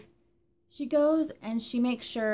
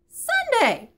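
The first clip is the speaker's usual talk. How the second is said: Sunday! shouted high and louder than line 13; loud exclamation; 14 semitones higher, 8 dB louder than usual. Real, not acted. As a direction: burst out with Sunday!, pitch leaping up. Repeats Sunday with more force.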